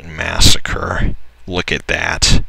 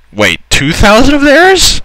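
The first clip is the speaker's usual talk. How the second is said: much higher, louder, slower stunned question; shocked question; 5 dB louder than usual. Real, not acted. Gamer seeing the battle odds flip, shocked squeal.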